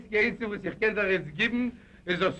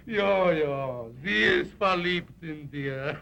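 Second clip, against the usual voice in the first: chuckling through the words; laughing; slower than usual. Acted. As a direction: laugh at the news while talking. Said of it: "Hör auf zu lachen".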